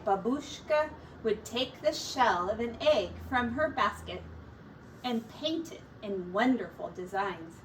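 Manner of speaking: ordinary delivery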